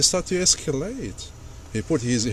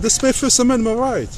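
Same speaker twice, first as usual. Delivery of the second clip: raised, high-pitched protest; insistent; 7 semitones higher than usual. Real, not acted. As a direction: defend the right, voice up high. Driver insists on recording.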